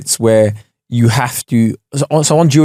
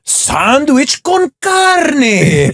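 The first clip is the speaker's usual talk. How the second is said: Duolingo voice; 10 semitones higher than usual. Retold or imitated. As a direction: drag it out like an over-keen app narrator. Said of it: "I love the voices on Duolingo".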